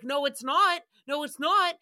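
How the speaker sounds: loud mocking denial; emphatic loud no. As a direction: flatly refuse him, loud. Retorting loudly to Pete.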